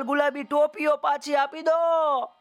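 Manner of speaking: high pleading call up into the tree; hawker's cry. Acted. Hawker calls up to monkeys for his caps. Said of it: "ફેરિયાએ રાડ નાખી".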